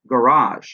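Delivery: "garage" with the second syllable punched; stress on second syllable. Shown as a demonstration. Teacher showing English stress, "garage" stressed on second syllable. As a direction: lean hard on the end of "garage", then fall. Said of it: "it's on the second one".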